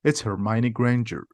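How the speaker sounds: ordinary delivery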